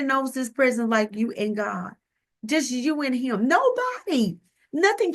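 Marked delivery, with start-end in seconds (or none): 3.5-5.1 nobody cried out high; a sudden high exclaim; high emphatic cry. Real, not acted. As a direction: leap way up on it. Emphatic preaching about God's presence.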